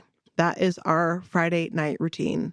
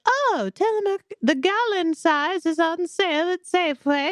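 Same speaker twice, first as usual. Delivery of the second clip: high, shrill-sounding witchy British voice; British witch voice; 10 semitones higher than usual. Retold or imitated. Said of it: "you don't sound like a British witch".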